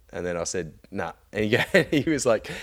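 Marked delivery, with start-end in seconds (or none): none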